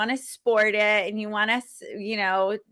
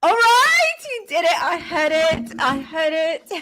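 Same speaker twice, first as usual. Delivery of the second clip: excited praise; 7 semitones higher, 4 dB louder than usual. Real, not acted. Excited praise after accent attempt.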